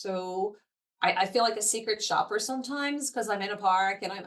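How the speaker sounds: ordinary talk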